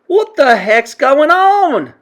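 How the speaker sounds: loud, high, drawn-out question; alarmed boy. A narrator's reading. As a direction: demand answers as a frightened boy. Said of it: "Bruno asked".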